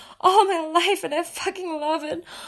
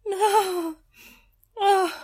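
ordinary talk, nothing marked

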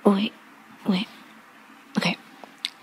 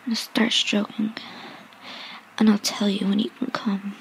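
breathy seductive ASMR whisper; sultry whisper; 5 dB quieter than usual. Acted. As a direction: whisper close to the mic, teasing.

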